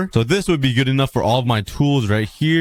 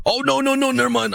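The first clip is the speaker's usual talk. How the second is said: much higher, alarmed "no, no, no"; startled high-pitched protest at a mistake; panicked no; 14 semitones higher than usual. Real, not acted. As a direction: shoot up high in sudden panic, then let go. Villager about to fall wrong.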